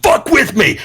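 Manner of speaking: high, put-on aggressive challenge; mock taunting yell. Wrestler taunts rival while swinging chair, mock taunting yell. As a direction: taunt him between blows.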